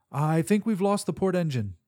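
alarmed report. Acted. Narrator reporting engine loss.

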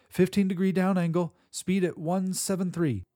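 loud readback; repeating back. Acted. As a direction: call it back clearly. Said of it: "I repeated".

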